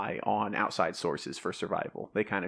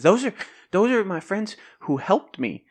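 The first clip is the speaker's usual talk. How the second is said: put-on eager little boy; 10 semitones higher, 7 dB louder than usual.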